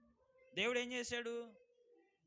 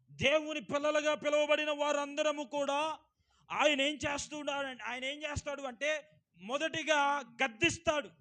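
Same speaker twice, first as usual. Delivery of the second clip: loud, ringing pulpit voice; fervent sermon delivery, raised and loud; preaching at full force; 6 semitones higher, 7 dB louder than usual. Acted. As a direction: preach it out to the whole hall. Preacher proclaims God rebukes first.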